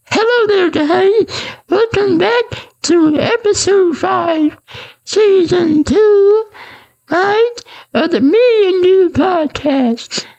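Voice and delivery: mock a cheesy podcast intro in a high voice; high put-on podcast voice. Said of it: "imagine if my voice was like".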